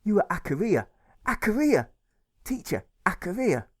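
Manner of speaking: put-on high, loud child calling out a name; excited little boy. A narrator's reading. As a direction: proudly announce her name like a small boy. Child Alfred voiced, excited.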